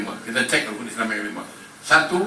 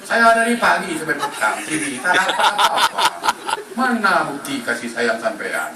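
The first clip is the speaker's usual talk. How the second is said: higher, half-laughing complaint; mock angry fisherman complaining to God; 5 semitones higher than usual. Fisherman scolding God for no catch, mock whining. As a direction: whine at God like a sulking fisherman.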